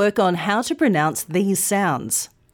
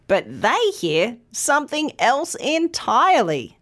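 ordinary delivery

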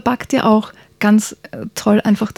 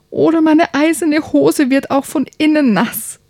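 put-on much higher, pleading child voice; urgent little prince; 8 semitones higher than usual. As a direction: beg like a kid who can't hold it. (dringend flehender kleiner Prinz, viel höher)

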